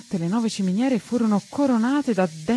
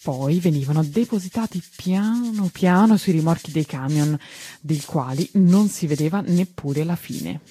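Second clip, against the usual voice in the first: ordinary delivery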